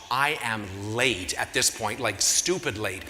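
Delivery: slow, low dread at being late; dead serious. Retold or imitated. Telling the audience how late he was, slow and grave. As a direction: drag it out, low and heavy.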